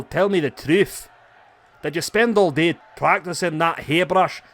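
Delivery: taunting Scottish wrestler; Scottish accent. Acted. Wrestler mocks a rival's big brain in a Scottish accent. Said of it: "my Scottish accent".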